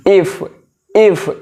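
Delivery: "एफ" alone, its opening "ए" clearly sounded; demonstrated pronunciation. Shown as a demonstration. Teacher shows students the vowel inside a consonant's name, demonstrated pronunciation. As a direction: say "एफ" as a letter name, vowel first. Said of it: "उच्चारण कीजिए".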